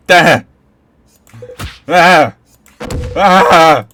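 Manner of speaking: loud high panicked scream; three startled screams in a row. Acted. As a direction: scream out in fright. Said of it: "Why are we screaming?".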